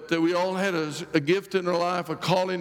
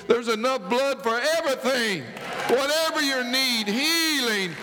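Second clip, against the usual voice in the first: preaching climax; 5 semitones higher than usual. Acted. Preacher proclaiming to the congregation, fervent and rising. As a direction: build the promise to the whole church.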